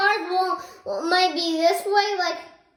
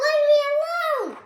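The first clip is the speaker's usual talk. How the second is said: pretend refusal; 9 semitones higher than usual. Child refuses pretend stranger.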